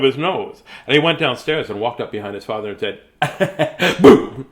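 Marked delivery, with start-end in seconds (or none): 3.2-4.5 sudden loud boo to scare; loud startling boo; shouted boo. Acted. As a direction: jump out and yell it. Boy scares his father.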